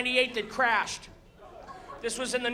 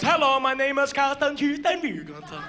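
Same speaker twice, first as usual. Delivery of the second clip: lightly voiced smooth captain; a hint of a calm ship captain on the PA; captain announcement; 2 semitones higher than usual. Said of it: "the captain comes on and goes".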